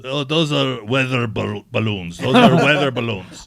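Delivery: imitating an Israeli officer's accent. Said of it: "in his Israeli accent".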